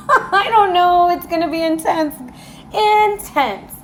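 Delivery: high-pitched, thrilled reaction; amazed excitement. Real, not acted. Reader reacts to an intense card drawn, amazed. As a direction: let the voice leap up in real amazement.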